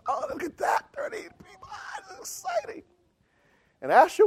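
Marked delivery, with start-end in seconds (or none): 0.1-2.8 high, hushed squeal of excitement; excited young believer. Retold or imitated. Young believer looking over the people coming forward, excited. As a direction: reenact a giddy new believer in a high, thin voice. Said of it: "and I was excited".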